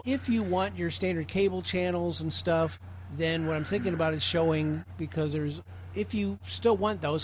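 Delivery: ordinary delivery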